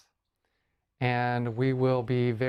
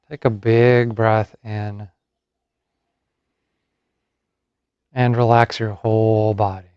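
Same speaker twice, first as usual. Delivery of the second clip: guided meditation; 10 dB louder than usual. Acted. Yoga teacher settles listeners into Yoga Nidra, guided meditation. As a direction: lead them gently into rest, phrase by unhurried phrase.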